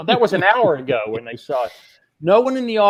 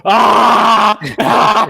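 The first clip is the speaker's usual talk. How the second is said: louder mock scream of the girls; imitated scream; 4 semitones higher, 7 dB louder than usual. The girls spotting the switch, loud mock scream. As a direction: burst out like shocked girls. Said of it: "and they start screaming".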